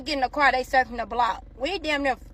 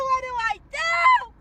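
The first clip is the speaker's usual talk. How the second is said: high, slow crying shout; hammed-up sob of despair; mock sobbing wail; 11 semitones higher, slower than usual. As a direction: wail it as if breaking down in tears. Repeated with a cry.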